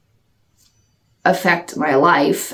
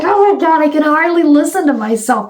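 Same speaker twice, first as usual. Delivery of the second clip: high, embarrassed self-reaction; mock dismay at hearing yourself; cringing; 8 semitones higher than usual. Vlogger reacting to her own first video, cringing.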